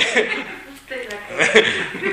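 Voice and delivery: high rising laugh; real laughter. Real, not acted. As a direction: laugh openly. Laughs again.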